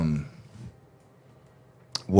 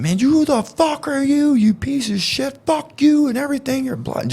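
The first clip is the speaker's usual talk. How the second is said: high, cursing tirade imitating a friend; retold yelling. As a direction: re-enact an angry rant in a strained high voice. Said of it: "he just starts yelling at him".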